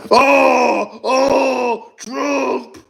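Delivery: high-pitched wailing imitation of a screamer; mock screaming cry. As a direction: mimic him bawling his lungs out. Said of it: "crying, but the screaming version".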